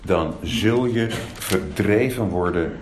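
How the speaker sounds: low, slow, weighty scripture quote; solemn warning. Acted. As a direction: pronounce it gravely, word by word. Quotes Moses' warning of exile.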